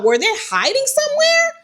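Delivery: very high, incredulous question; baffled disbelief. Retold or imitated. Seeing many men come out of the house, incredulous. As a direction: pitch it way up, astonished.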